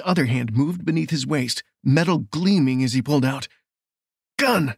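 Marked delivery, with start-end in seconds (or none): 4.4-4.7 higher, urgent shout; shouted warning. Acted. Lawman spots the pulled weapon, urgent shout. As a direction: call out the alarm. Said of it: "Nathan shouted".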